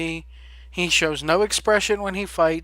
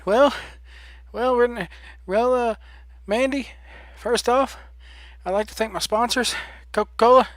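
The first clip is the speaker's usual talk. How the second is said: higher, sponsor-thanking wrestler, a touch breathless; a tired winner thanking his sponsors into the mic; mock winded wrestler; 4 semitones higher than usual. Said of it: "they're gonna be panting".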